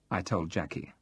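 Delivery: plain reading voice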